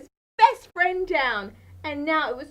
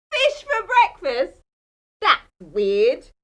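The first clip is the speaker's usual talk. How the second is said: louder, playful disbelief; mock surprise; 2 semitones higher, 8 dB louder, slower than usual. A narrator's reading. Telling kids about fish breakfast, playful mock surprise. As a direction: play up the odd detail.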